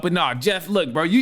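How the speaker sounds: ordinary delivery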